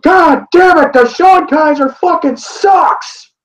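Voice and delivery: high, drawn-out mock scream; imitated scream. Angry fan walking back through the tunnel, screaming. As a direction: imitate a furious fan wailing it out slowly. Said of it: "he just screams".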